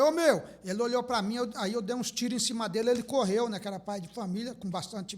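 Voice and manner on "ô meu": loud, high shouted call; sharp shout. Retold or imitated. Calling out to the armed homeowner, a loud shout. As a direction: bark it out to grab his attention. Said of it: "Aí eu olhei pra ela e falei".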